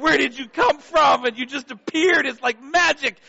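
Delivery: put-on high, loud startled child shouting; a scared little boy caught sneaking; freaked-out kid. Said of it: "he's, like, freaking out".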